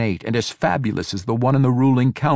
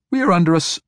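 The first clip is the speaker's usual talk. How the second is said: high, urgent warning suddenly broken off; frantic warning, abruptly halted; cut off mid-word; 9 semitones higher, 4 dB louder, much faster than usual. A narrator's reading. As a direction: start an alarmed cry and stop dead. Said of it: "her voice stopped as she froze".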